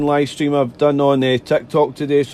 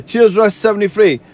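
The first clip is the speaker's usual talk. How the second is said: laughing; 5 semitones higher, 4 dB louder than usual. Real, not acted. Signing off while laughing.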